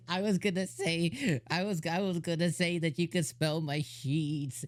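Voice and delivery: imitated line through held-in giggles; stifled laughter. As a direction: quote him while trying not to laugh. Retold line, cracking up throughout.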